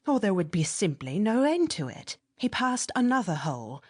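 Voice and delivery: plain reading voice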